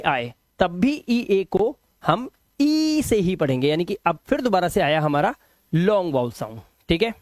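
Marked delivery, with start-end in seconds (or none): none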